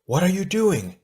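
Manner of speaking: high, alarmed question; exclaiming. A narrator's reading. Gali questions Tahu as his mask glows, exclaiming. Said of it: "she exclaimed".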